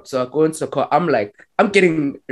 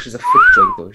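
high, louder excited praise; 5 dB louder than usual. Real, not acted. Praising the woman to friends, a high excited gush. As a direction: gush about her.

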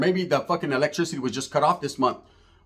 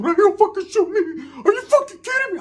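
high squeaky panicked yelling; high-pitched mock panic with a laugh; freaked-out yell; 12 semitones higher than usual. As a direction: screech it like he can't believe it.

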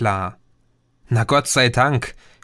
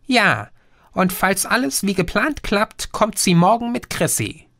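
lightly voiced alien, somewhat higher; a patient know-it-all explaining; Green's voice; 7 semitones higher than usual. Green confirms and spells. (leicht höher gesprochener Außerirdischer, belehrend)

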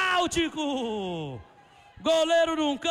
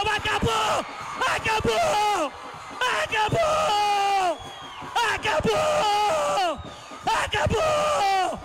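ecstatic final whistle shout; 5 semitones higher, much slower than usual. Real, not acted. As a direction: scream it, the title is won. Match ends, title won.

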